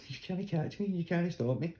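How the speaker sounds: high teasing dog voice; taunting dog voice. Retold or imitated. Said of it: "You're like".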